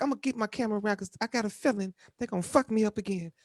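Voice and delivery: lightly voiced coworker, fast and high; imitating her. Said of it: "she's like".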